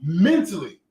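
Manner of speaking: usual speaking voice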